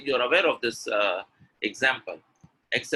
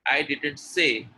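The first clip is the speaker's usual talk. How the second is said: weight lands on the final "say"; stress on say; slower than usual. Shown as a demonstration. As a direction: lean on "say". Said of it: "when I stress on say".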